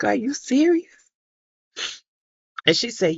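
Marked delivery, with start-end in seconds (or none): 0.0-0.9 high, stunned "Are you serious?"; asking in wide-eyed disbelief. Retold or imitated. Mother told of her son's prayer request, disbelieving.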